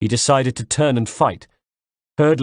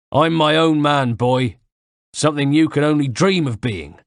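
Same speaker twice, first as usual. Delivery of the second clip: higher, louder scornful voice; taunting villain; 5 semitones higher, 4 dB louder than usual. Acted. Knife man scorns the narrator. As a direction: belittle him.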